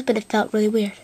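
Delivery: ordinary delivery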